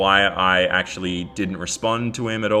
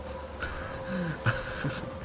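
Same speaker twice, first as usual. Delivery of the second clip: breathy, quiet giggle; helpless laugh; 10 semitones higher, 11 dB quieter than usual. Real, not acted. YouTuber finding the troll's messages absurd, soft breathy laugh. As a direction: laugh softly in disbelief.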